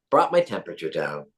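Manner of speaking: ordinary delivery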